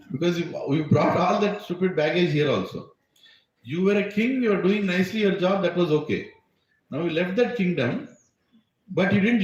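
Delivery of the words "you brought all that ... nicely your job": ordinary talk, nothing marked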